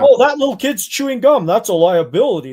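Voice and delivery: exaggerated mock panic over chewing gum; mocking attorney. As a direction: ham up a paranoid lawyer. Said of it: "mocking the attorney".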